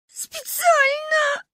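put-on very high, long-drawn child's wail; howling. A narrator's reading. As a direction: wail СПЕЦИАЛЬНО, stretching it out. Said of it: "завыла Маня".

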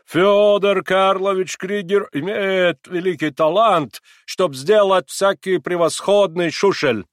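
high, loud, slow delighted German; excited German accent. A narrator's reading. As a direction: brag with growing delight. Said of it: "начиная приходить в восторг".

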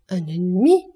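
loud, wrathful question, rising sharply; indignant exclamation. A narrator's reading. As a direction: throw the word back in outrage. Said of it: "s'écria Mercédès avec un regard de courroux". (exclamation courroucée, en question montante)

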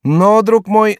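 high, loud objection; lively high character voice; remonstrating jester. Acted. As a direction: cut in with a high-pitched reminder. Chicot objects to monk.

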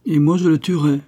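put-on proud boast of the young duellist; heroic. A narrator's reading. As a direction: declare it like a fearless knight. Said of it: "dit héroïquement Roland".

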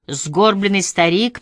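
usual speaking voice

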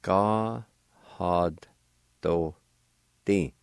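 Ga had do di, each syllable separate with a pause between. Shown as a demonstration. Teacher sounds out the word for radio learners, slowly. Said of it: "pronounce the D sound with the H A sound like this".

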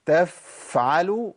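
تفعلوا slow with a stretched فاء; overlong faa. Shown as a demonstration. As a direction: drag the فاء too long. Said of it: "ده كتير". (فاء ممدودة أكثر من اللازم)

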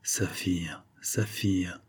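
hushed, restrained calling of her name; murmured. A narrator's reading. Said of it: "murmura monsieur de la Morlière".

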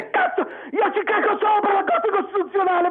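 high-pitched angry yelling; real enraged tirade; furious outburst. Real, not acted. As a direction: shout it with open contempt. Caller raging at the Court.

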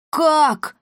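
high-pitched, astonished cry; startled exclamation. A narrator's reading. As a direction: exclaim it in disbelief. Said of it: "воскликнул Пима". (изумлённое восклицание)

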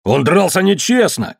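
high-pitched accusing cry; обвиняющий крик. A narrator's reading. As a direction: cry foul, pitch well up. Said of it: "крикнул Томбол". (высокий обвиняющий выкрик)

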